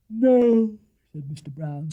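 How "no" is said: stifling a yawn. Acted. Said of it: "stifling a yawn".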